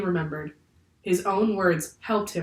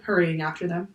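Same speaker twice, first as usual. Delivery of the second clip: ordinary delivery, nothing marked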